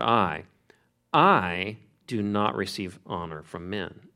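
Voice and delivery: "I" held long and strong before the rest; stress on I. Shown as a demonstration. Preacher showing the verse's emphasis, stress on "I". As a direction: hit "I" hard, then continue slowly. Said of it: "there's an emphasis there on the word".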